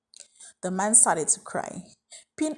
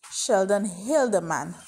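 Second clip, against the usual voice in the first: plain reading voice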